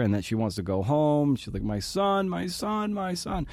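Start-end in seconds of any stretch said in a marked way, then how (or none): 1.6-3.5 high, soft mock wail; imitated panicked cry. Mom calling from the bathroom, imitated panicked cry. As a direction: mimic a panicked mother's cry, high but quiet. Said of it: "she's just screaming from the bathroom".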